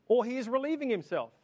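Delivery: high, scornful jeer; mocking. A narrator's reading. Elijah mocking Baal's prophets, high scornful jeer. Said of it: "Elijah mocked them".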